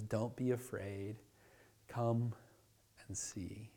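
soft and slow, ending in a whisper; tender hushed invitation; gentle whisper. Pretend. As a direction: soothe them, barely breathing the words. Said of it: "Jesus shows up and says".

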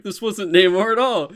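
high, loud mock outrage; angry fan. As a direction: rant like an angry comic fan. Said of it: "You're like".

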